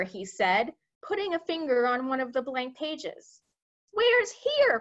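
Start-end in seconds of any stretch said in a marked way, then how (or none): 3.9-4.8 high, loud yelp of disbelief; bewildered cry. A narrator's reading. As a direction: cry out as a baffled army chief. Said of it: "cried the head".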